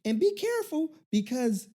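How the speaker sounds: very high, preachy auntie warning. Retold or imitated. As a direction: lecture him like a nosy aunt. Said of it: "this is my auntie voice".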